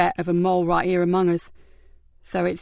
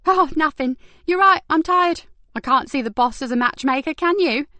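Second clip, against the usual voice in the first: put-on lightly higher young woman voice; brisk higher detective voice; Kat voice; 8 semitones higher, 4 dB louder than usual. A narrator's reading. Kat covering embarrassment.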